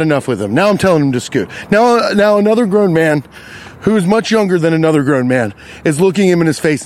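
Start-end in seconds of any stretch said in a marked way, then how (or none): none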